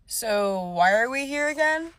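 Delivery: lightly voiced confused guy; a hint of a clueless date. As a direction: play him as lost. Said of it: "Until he says".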